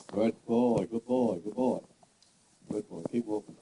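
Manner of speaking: low, soft, soothing praise; soothing a horse. Real, not acted. Trainer soothing a nervous young horse, softly and low.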